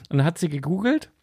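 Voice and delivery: ordinary talk, nothing marked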